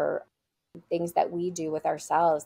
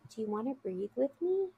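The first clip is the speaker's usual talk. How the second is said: soft, higher-pitched tentative offer; gentle child; 6 semitones higher, 7 dB quieter than usual. Retold or imitated. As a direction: imitate a child gently approaching a friend. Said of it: "crawls like a little kitty cat next to her and says".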